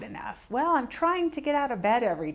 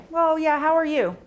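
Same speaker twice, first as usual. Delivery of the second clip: dismissive greeting; 7 semitones higher, 6 dB louder than usual. Retold or imitated. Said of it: "I'm not saying, you know".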